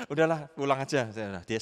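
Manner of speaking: ordinary talk, nothing marked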